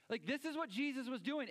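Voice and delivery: ordinary talk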